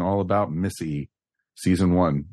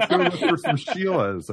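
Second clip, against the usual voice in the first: mock Aussie accent; 9 semitones higher than usual. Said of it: "you went there".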